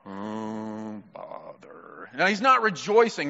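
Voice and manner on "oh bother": very low, soft, glum drone; Eeyore. Retold or imitated. Preacher showing how a joyless donkey talks, glum impression. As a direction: sigh it out like a dejected cartoon donkey. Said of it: "When Eeyore talks, he's not rejoicing".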